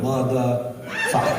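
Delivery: slow "motherfucker" pulled apart as mother, fucker; split into two words. Retold or imitated. Mocking his wife's careful "motherfucker", slow and split. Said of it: "She thought it was two words".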